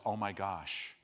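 low, soft mock dread; hushed dread. Parents spotting a speedboat near their son, hushed dread. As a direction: drop the voice as the danger dawns.

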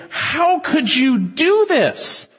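high, very slow horrified question; aghast sailors. Acted. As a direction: stretch out their disbelief.

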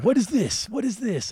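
high-pitched imitation of a suspicious cop; questioning officer. Officer finding a pipe, high suspicious questioning. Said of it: "He's like".

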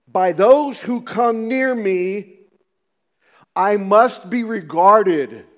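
loud, slow proclamation; booming voice of God. Acted. Preacher booming God's words to the congregation, loud and slow. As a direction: thunder it out slowly, like God speaking.

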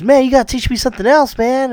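high hurried whine; pleading student. Retold or imitated. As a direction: rush out the plea like an eager kid. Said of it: "He goes".

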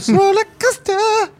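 high-pitched. Pretend. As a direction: stretch it out way up high. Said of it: "I could hit those high notes".

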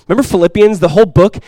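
usual speaking voice

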